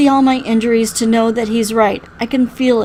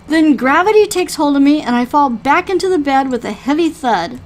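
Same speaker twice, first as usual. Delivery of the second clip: plain reading voice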